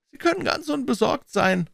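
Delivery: high-pitched, mildly amused reassurance; lightly entertained, pitched well up. A narrator's reading. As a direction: reassure him with a touch of amusement. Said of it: "meinte Stetland belustigt".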